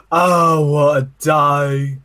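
milk every word of it; drawn-out mock catchphrase. Stretched reply to the guy at the urinal. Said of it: "And I turn around and go".

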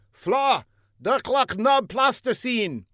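high, put-on foreign voice, calling out; a silly secret agent calling across the street; high comic foreigner. A narrator's reading. Older agent calls to Fla down the block, high comic voice.

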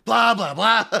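loud, high mocking babble; mock yell of blah blah. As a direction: shout it like a bratty taunt.

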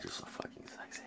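hushed, voiceless mock whisper. Mimicking the visitor's sudden turn from disgust, whispered. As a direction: let it drop away to an acted whisper.

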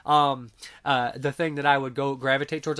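ordinary talk, nothing marked

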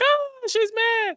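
very high, drawn-out "ooh"; hammed-up slow, high-pitched reaction; mock alarm. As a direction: sing out high and slow, scandalized. Own gleeful reaction retold.

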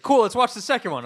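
mock eager friend. Friend insisting on the sequel, eager and loud. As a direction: sell the idea brightly. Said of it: "he was like".

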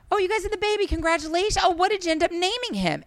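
high, bright mock-sweet asking; sweet innocent ask. Asking the husband about the baby, mock sweet. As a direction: feign friendly curiosity. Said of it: "I said to him".

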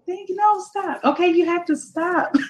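high, giggly, embarrassed protest; stifled giggles. Real, not acted. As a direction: squeal it out, flustered. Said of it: "I will blush forever".

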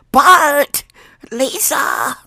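high, raspy cartoon mother calling; high cracked cartoon mom impression; Marge Simpson. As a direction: call the kids in a strained high rasp. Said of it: "Marge Simpson voice".

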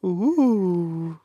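high, loud ghostly ooh; spooky ooh. Pretend. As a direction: moan it like a ghost. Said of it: "That's not it".

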